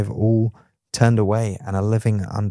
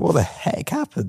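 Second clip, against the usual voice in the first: shocked; 7 semitones higher than usual. Acted. Voicing Paul's shock, high.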